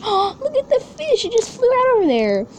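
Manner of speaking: gasp, then high-pitched delight; excited squeal. Real, not acted. Collector spotting the fish that flew out, excited squeal. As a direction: squeal in happy surprise.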